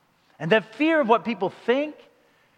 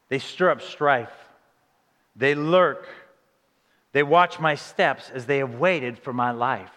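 solemn scripture reading; 6 semitones lower, slower than usual. Acted. Reads the psalm verse aloud.

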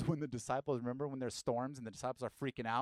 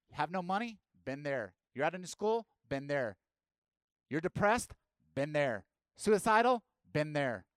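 louder and higher with each question; building intensity; 4 semitones higher, 9 dB louder than usual. Real, not acted. Preacher listing hardships he lived through, building louder. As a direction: build each hardship until suicidal peaks.

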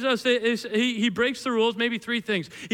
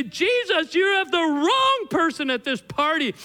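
loud, high-pitched mock outrage; indignant scolding; 6 semitones higher, 4 dB louder than usual. Pharisees telling Jesus he has the wrong person, indignant. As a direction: call him out in exaggerated protest.

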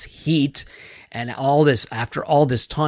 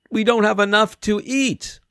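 higher, anxious complaint; worried disciples; 7 semitones higher than usual. Retold or imitated. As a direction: fret about running out of food. Disciples worrying about food.

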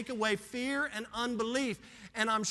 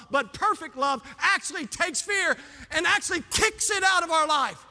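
loud, high, shouted sermon climax; preaching at full force; 4 semitones higher, 5 dB louder than usual. Acted. Pastor urging believers to walk by faith, shouting and fervent.

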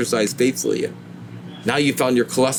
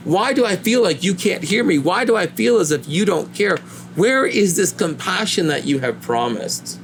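higher, pleading cry of someone in pain; anguished plea to God; 6 semitones higher than usual. Retold or imitated. A believer in pain and loss cries out to God, pleading. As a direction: call out to an absent God, aching. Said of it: "at times you thought".

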